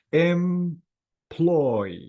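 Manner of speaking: "Employ" drawn out as em, ploy; two syllables. Shown as a demonstration. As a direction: hold each half of "Employ", em then ploy. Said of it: "two syllables".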